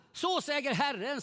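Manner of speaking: loud, high, slow solemn declaration; prophetic voice. Retold or imitated. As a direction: proclaim like a prophet from the back of the room. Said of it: "säger en längst bak i lokalen".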